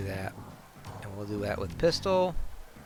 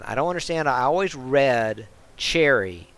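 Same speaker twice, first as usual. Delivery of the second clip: ordinary talk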